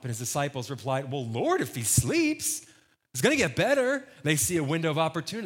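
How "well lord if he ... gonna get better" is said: hopeful protest. Acted. Disciples talking Jesus out of going, acted with hammed-up hope. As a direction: seize on the loophole, pitch leaping up. Disciples eagerly seizing an excuse.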